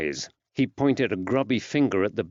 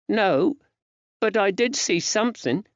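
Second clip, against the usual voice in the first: put-on high-pitched small boy answering; child's high voice; young lad; 11 semitones higher than usual. A narrator's reading. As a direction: voice a little boy, much higher.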